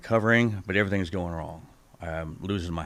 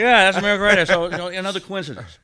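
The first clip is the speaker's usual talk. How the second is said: laughing exclaim; 12 semitones higher, 10 dB louder, faster than usual. Real, not acted.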